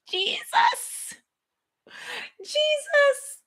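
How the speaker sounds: real overwhelmed cry to Jesus; breaking into a high cry of awe. Real, not acted. Overwhelmed by the revelation.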